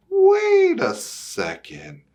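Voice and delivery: high, slow, startled squeak; a bird suddenly doubting the cat; surprised bird. Acted. Bird objects in the story.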